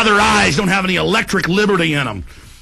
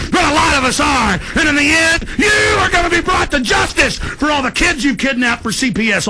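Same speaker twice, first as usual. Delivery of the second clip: higher, yelling in outrage; furious shouting; 8 semitones higher than usual. Real, not acted. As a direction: scream it at them, furious.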